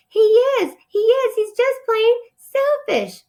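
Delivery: high, squeaking chorus of tiny mice; squeaky little mice. A narrator's reading. Teacher reading the little mice, high and squeaky. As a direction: pipe it up like a crowd of tiny mice. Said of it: "squeaked the little brothers and sisters and cousins".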